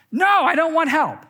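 high, loud whining refusal; whiny child. Retold or imitated. Child refusing mom's help, high whining shout. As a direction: shout it like a bratty kid.

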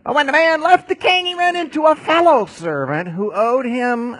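loud, high, driving preacher; preaching at full force. Acted. Preacher drives the unforgiving servant story, loud and high. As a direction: hammer the parable home.

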